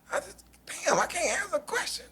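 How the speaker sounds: high, fast, wide-eyed innocence; mock innocent. Joker playing innocent to the fighters, high and fast. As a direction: play dumb in a squeaky voice.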